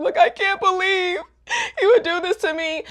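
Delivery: high, hammed-up wail; put-on sobby lament; mock tearful heartbreak. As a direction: imitate a dramatic girl crying. Heartbroken girl runs out after note.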